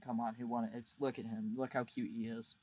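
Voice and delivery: ordinary delivery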